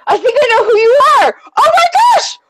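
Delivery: high, loud, squealing realization; fangirl freakout. Real, not acted. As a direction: shriek it as you recognize a fan. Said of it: "now I'm freaking out".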